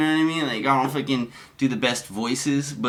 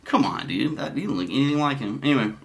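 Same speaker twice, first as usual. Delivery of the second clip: ordinary delivery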